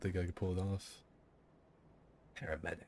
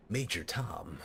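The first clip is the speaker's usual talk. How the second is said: Snake voice; slower than usual. Retold or imitated. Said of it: "I can't get the Snake voice down".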